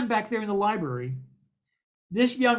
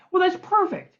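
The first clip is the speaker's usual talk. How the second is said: much higher, louder mock delight; eager producer; 11 semitones higher, 5 dB louder than usual. Producer hears he's a researcher, much higher delight. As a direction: beam it like a salesman.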